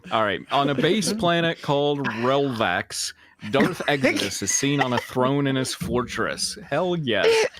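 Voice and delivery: ordinary delivery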